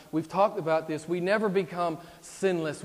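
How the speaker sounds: normal talking voice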